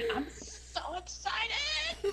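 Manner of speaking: put-on squeaky, slow, giggling kid voice; cartoon kid voice. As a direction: stretch it out in a silly cartoon kid voice. Funny voice in recording.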